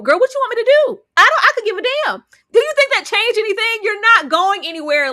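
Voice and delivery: high, loud, fast exasperation; exasperated outburst. Retold or imitated. Shunammite scolding Daisy loudly, high and exasperated. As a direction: throw up your hands at her.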